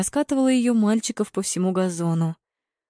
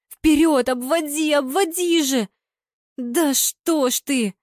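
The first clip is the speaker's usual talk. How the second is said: high, impatient cries, ending slower in exasperation; frantic urging; 8 semitones higher, slower than usual. A narrator's reading. Fan pushing the forward to dribble, frantic urging. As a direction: urge him on, then despair.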